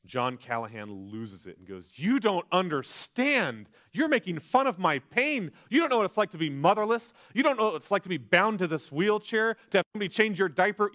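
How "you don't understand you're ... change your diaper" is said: loud, high furious outburst; exploding in rage. Retold or imitated. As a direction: let it all blow up at them. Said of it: "And he explodes".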